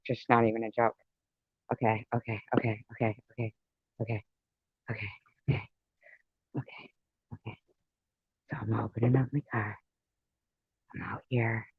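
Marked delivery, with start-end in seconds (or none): 1.7-7.6 hushed nervous okays. Real, not acted. Bracing himself out in the cold. 10.9-11.7 whisper. Real, not acted. Sneaking out alone at night.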